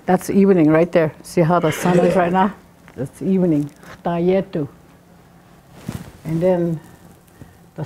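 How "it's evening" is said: normal talking voice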